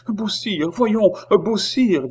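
high, drawn-out pleading call; mildly frightened. A narrator's reading. Oliva tries to stop Beausire, mildly frightened. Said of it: "voix qui n'était pas assez épouvantée".

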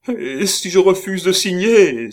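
high, loud whimpering protest; tearful cry. A narrator's reading. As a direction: cry it out in a whining, tearful plea. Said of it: "s'écria-t-il en larmoyant".

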